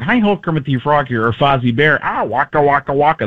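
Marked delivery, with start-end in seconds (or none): 0.0-1.2 nasal puppet frog; Kermit the Frog. Shown as a demonstration. Comedian demonstrating similar voices, nasal frog. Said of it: "if you take Kermit the Frog". 2.0-3.2 throaty puppet bear catchphrase; Fozzie Bear. Shown as a demonstration. Comedian demonstrating puppet voices, throaty bear. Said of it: "that's a nasal voice and a throat voice".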